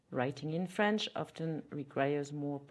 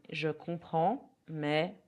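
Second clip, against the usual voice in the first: slow, careful French model; teacher modelling a phrase; slow repeat-after-me; slower than usual. Shown as a demonstration. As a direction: stretch it out for learners to copy. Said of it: "You can repeat after me".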